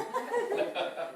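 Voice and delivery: short airy giggle; breathy high laugh. Real, not acted. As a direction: laugh at the doubter. Laugh after the mock reply.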